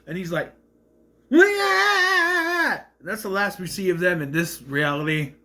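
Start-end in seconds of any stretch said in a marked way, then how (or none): 1.3-2.8 long, high, loud mock scream; imitated scream. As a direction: scream it out in comic terror, held long. Imitates his scream at crocodile.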